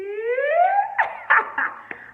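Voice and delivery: a loud, high squealing laugh; high burst of laughter. Real, not acted. Laughing at an older man's proposal, loud and high. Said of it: "I started laughing".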